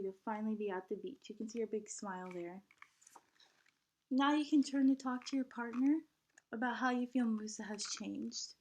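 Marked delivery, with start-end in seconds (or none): none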